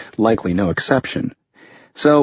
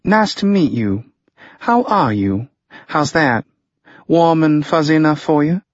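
mock pleasant; 6 semitones higher than usual. A narrator's reading. Harper mock pleasantries, sarcastic.

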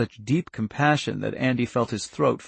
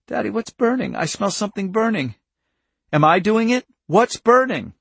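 put-on high-pitched, alarmed little girl; rising panic in a child's high voice; frightened child; 10 semitones higher, 6 dB louder than usual. Charlie asks Daddy what's burning, frightened.

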